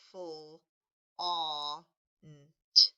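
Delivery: "Full" as the fl blend, then "aw", then "nt"; blending sounds. Shown as a demonstration. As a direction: sound out flaunt in chunks, fl, aw, nt. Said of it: "put that blend together".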